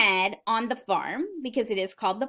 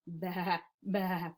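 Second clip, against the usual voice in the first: soft, low little baas; quiet sheep bleat; 3 semitones lower, 8 dB quieter than usual. Acted. Sheep after the farmer's scolding, quiet low bleating. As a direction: bleat gently so nobody wakes. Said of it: "they quietly made their noises".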